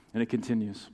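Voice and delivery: ordinary talk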